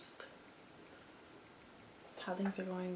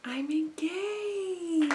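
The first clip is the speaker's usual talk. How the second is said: drawn-out high joyful announcement; excited; 10 semitones higher, 6 dB louder, much slower than usual. Real, not acted. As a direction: belt it out, thrilled. Big surprise reveal.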